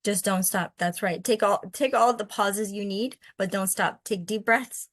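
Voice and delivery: ordinary delivery, nothing marked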